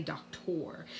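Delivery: "doctor" pronounced dock-TOR with a full O; OR ending. Shown as a demonstration. Teacher rejects a pronunciation, "doctor" as dock-tor. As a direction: make the last syllable of "doctor" rhyme with "for". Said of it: "We don't say doctor".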